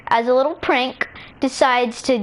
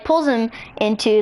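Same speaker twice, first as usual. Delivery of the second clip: usual speaking voice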